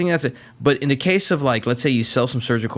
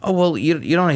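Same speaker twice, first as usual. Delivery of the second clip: retold lady; 7 semitones higher, 3 dB louder than usual. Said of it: "And she's like".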